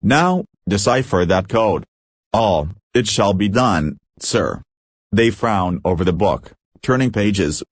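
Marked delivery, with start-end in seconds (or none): none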